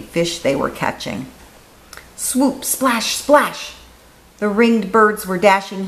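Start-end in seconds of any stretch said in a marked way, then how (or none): 2.2-3.7 splashing sound words. A narrator's reading.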